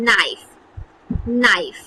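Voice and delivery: নাইফ said with no K sound at the start; silent K in knife. Shown as a demonstration. Teacher shows the class নাইফ with the K silent. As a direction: model নাইফ, starting straight on the N. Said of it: "আমরা কি উচ্চারণ করছি".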